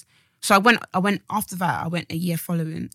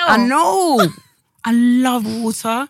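hammed-up declaration; 5 semitones higher, 6 dB louder, much slower than usual. Exaggerated reply, slow and loud.

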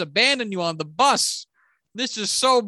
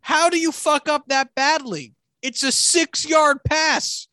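shout; 4 semitones higher than usual. Real, not acted. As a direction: yell out how easy it was. Shouting about the easy pass.

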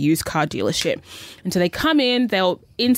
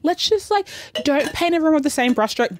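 mock indignant; 8 semitones higher than usual. Her past self defending dealers.

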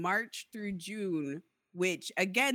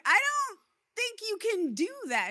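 much higher, mock polite disbelief; incredulous quote; 12 semitones higher than usual. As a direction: play it high and falsely sweet, doubting them. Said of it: "I'm like, I'm like".

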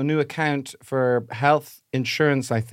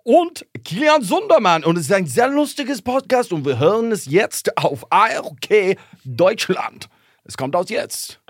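slow, higher German radio announcer with stretched words; 3 semitones higher, slower than usual. Acted. Introducing the podcast on stage, in a slow, higher German radio ad voice. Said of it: "as a German radio ad".

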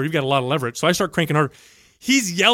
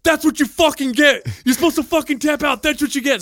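mock coach yelling; 11 semitones higher than usual. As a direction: bark it high and hard at the man on the floor. Retold coach yelling at opponent.

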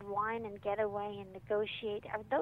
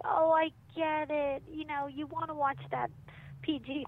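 mock understanding; 5 semitones higher than usual. Acted empathic reply to kid.

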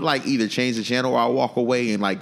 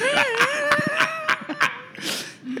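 high shrieking burst of laughter; 4 dB quieter than usual. Real, not acted. Laughs at Biggity bumps.

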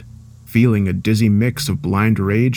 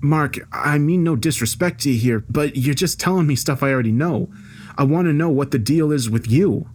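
higher-pitched, careful objection; raised-pitch frustrated challenge; exasperated employee; 5 semitones higher than usual. Acted. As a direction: push back politely but impatiently. Voices Tom challenging his boss.

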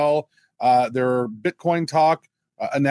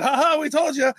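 higher mocking gloat; sing-song told you so, pitched up; mock gloating doubters; 11 semitones higher than usual. Co-host mimicking sceptics gloating, higher mock voice. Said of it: "And then it goes back down".

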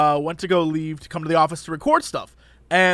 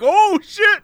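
high, startled exclamation; mock alarm; 12 semitones higher, 5 dB louder than usual. Reacting to his mom's warning message, high startled mock alarm. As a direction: yelp it out, alarmed. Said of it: "And I was like".

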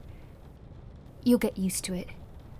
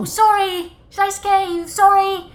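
high, loud apology called across; calling out apologetically; 9 semitones higher, 8 dB louder than usual. Acted. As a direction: raise your voice to be heard, pitched up high. Startled the guest, repeats the offer.

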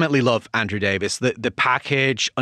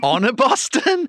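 surprised; 11 semitones higher, slower than usual. Real, not acted. Mishearing a garbled title.

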